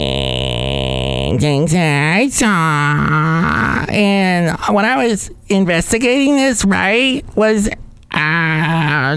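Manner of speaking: put-on high creaky vocal fry valley girl. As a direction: drag each word in vocal fry. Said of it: "with total vocal fry girl".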